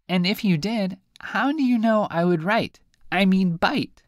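high, squeaky little fish voice; high teasing fish; talking fish. Acted.

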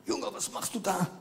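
put-on a stranger catching the boy, scolding him; scolding man. Startled stranger scolding the boy at the roadside. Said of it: "er schimpft und sagt".

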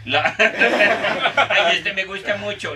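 high giggling through the words; laughing. Real, not acted. Host reading the silly title, laughing. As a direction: read it while cracking up.

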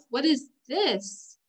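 ordinary talk

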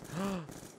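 exaggerated little gasp; quick put-on gasp of surprise; mock gasp. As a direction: imitate her sharp intake of breath. Retold date's shocked reaction.

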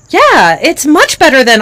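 beaming with pride; girl thrilled by dad's praise; delighted child. Acted.

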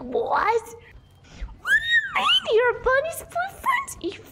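high shocked outraged shriek; imitating Sophie. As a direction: squeal it in disbelief. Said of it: "Sophie's gonna be like".

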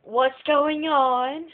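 high, slow, loud mock panic; panicked clerk. Front desk staff panicking at the angry guest, shrill and slow. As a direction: squeal it out in slow alarm. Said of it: "They're like".

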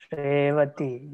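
"Revati" said slowly, with the first syllable held long and heavy; slow syllable demo. Shown as a demonstration. Teacher showing students which syllables are long, slow and deliberate. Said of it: "long and heavy".

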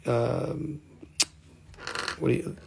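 ordinary talk

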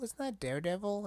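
double-take at the figure; surprised repeat. Real, not acted. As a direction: pitch up on not in disbelief. Repeats in disbelief.

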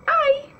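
high-pitched mock "ouch"; pretend cry of pain. Teacher showing what we say when it hurts, mock pained yelp. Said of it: "quando uma coisa dói".

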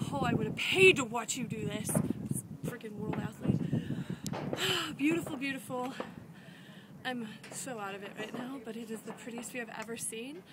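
breathless, half-whispered words; winded whisper after a hard climb; out of breath. Real, not acted. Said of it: "after I catch my breath".

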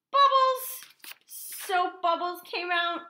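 high, loud shout, then drawn out; excited reveal. A narrator's reading. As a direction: burst out with the silly surprise. Surprise reveal in the story.

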